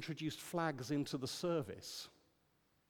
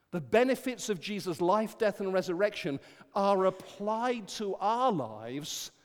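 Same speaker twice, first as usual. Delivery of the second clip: ordinary delivery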